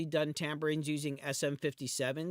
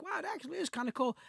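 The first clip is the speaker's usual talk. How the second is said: impressed commenter; 12 semitones higher, faster than usual. Retold or imitated. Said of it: "People are like".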